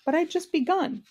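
high-pitched protest, rising well above narration; objecting. A narrator's reading. Milo protesting to the king who cut him off, objecting. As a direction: object in a high young hero's voice. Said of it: "objected Milo".

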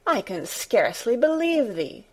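mild protest. A narrator's reading. Martha doubting Mary's story, mild incredulous protest. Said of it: "she protested".

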